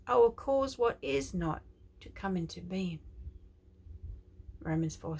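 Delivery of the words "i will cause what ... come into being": prophetic declaration. Acted. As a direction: proclaim it like a prophet. Prophetic quote.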